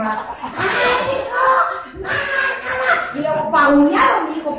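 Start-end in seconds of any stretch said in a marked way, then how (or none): 0.0-3.1 long, high call to the referee; mock shout at the referee. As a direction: yell it out slowly across the field. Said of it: "con mi vocecita que tengo". (grito burlón y agudo al árbitro)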